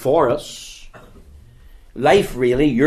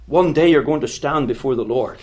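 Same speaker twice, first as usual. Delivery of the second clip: usual speaking voice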